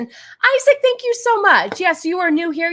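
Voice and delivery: high, loud burst of gratitude; excited thanks. Real, not acted. Streamer greets a new subscriber, excited and loud. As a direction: call out his name, delighted.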